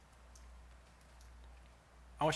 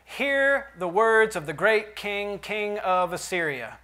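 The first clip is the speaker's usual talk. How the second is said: slow, louder herald's call; envoy shouting up to the people on the wall; loud proclamation; 5 semitones higher, 5 dB louder, slower than usual. Acted. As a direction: proclaim it slowly across the city wall. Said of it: "He called out in a loud voice".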